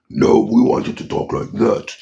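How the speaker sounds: rough, growled monster voice; gruff Thing voice. Shown as a demonstration. Fan correcting the Thing's voice, gruff growl. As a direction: growl it like a big rock brute. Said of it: "they want back the gruff voice of the thing".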